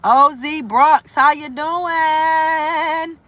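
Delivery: high, slow and stretched out hello; excited greeting. Real, not acted. Greeting a viewer by name, drawn out and high. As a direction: call out to a friend joining.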